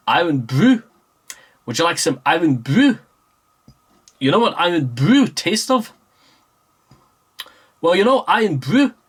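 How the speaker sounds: a weak attempt at a Scottish accent, rising tune; half-hearted Scottish accent; bad Scottish accent. Vlogger mocking his own Scottish accent, half-hearted. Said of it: "the only real Scottish phrase I can actually pull out".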